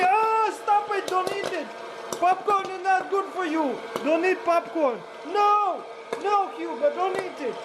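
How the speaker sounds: high, panicked no-no-no; frantic pleading. Real, not acted. Owner frantically stops dog Hugo eating popcorn, high pleading. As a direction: beg the dog to leave it, voice squeaking up.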